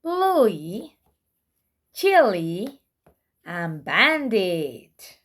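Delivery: usual speaking voice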